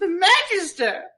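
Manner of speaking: high, wavering granny voice; old lady voice. Acted. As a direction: plead like a frail old woman. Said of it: "my best old lady voice".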